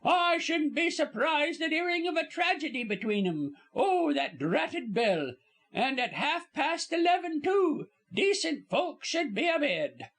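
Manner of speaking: high-pitched chattering servant, dropped aitches; high, scandalised working-class gossip; gossiping Cockney servant woman. Acted. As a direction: prattle on indignantly in a high Cockney voice. Servant delivers her last word.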